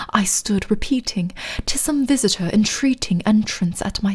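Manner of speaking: hushed, dramatic mock-British recitation; fake British accent. Streamer continuing The Raven for viewers, fake British accent. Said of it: "You guys really want the fake British accent?".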